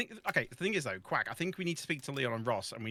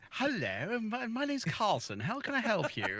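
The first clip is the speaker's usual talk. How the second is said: slow, higher posh telephone greeting; posh phone voice; 4 semitones higher than usual. Retold or imitated. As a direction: answer the phone in mock proper English. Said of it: "is it your phone voice?".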